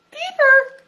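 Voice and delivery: high, loud squeak of alarm. A narrator's reading. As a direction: shriek the word in fright. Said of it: "squeaked Piglet".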